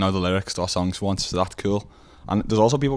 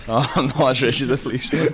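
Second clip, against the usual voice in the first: laughing; 6 semitones higher, 4 dB louder than usual. Real, not acted. Repeating the host's line, laughing.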